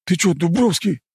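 high, blurted out in shock; stunned blurt. Acted. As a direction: blurt it, floored. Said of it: "ошалело выпалил ушастый".

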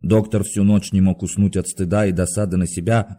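usual speaking voice

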